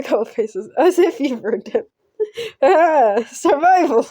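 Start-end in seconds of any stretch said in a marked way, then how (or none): 2.6-4.1 excited. Real, not acted. Streamer cheers on surviving.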